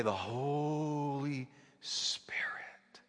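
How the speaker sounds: breathy reverent whisper, drawn out slowly; imitating a hushed whisper. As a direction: whisper it like the preacher in awe. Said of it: "she would say".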